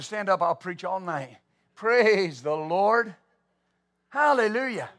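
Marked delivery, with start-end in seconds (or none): none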